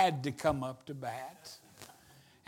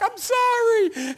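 high, drawn-out sorry; hammed-up sheepish apology; mock flustered apology; 14 semitones higher, 4 dB louder than usual. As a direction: plead it in a raised voice. Flustered apology after yelling, acted.

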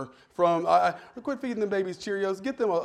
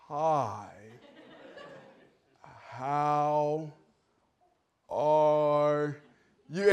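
each word dragged out with long pauses, low and sleepy; slow sloth voice; 4 semitones lower, 5 dB quieter, much slower than usual. Retold or imitated. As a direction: play the sloth, painfully slow. Sloth answers at DMV counter.

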